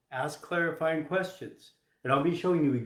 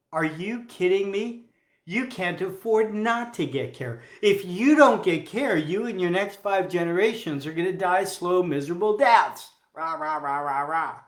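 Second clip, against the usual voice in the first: higher, louder mock tirade; hammed-up pushy doctor; 5 semitones higher, 5 dB louder than usual. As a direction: overdo the bossy doctor lecturing a patient. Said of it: "what might an assertive say".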